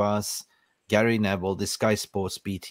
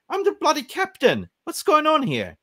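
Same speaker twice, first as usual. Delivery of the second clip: outraged captain; 4 dB louder than usual. Retold or imitated. Voices outraged captain Bruno.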